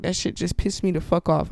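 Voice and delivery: normal talking voice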